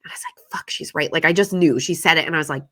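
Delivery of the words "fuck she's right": breathy whisper of defeat; unvoiced resigned whisper; whispered realization. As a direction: whisper it to yourself.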